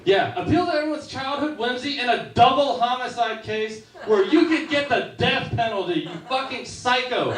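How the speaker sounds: ordinary talk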